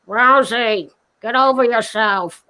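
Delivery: lightly mimicked drawling scold; Penny Marshall. Celebrity tells off the upset guest, mimicked scolding. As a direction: imitate an unimpressed friend's put-down. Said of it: "Penny Marshall was there ... she said to me".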